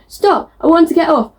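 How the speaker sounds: high-pitched yell without real volume; mock yell. Narrator voicing a woman fed up with the world, mock yell. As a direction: act out a yell, lightly. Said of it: "she's wanted to yell at the world".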